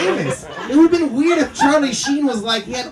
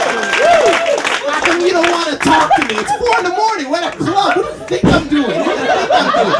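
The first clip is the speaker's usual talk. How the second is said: loud, higher mock indignation; exasperated complaint; 6 semitones higher, 7 dB louder than usual. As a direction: rant at them in put-on exasperation. Acted complaint to club girl.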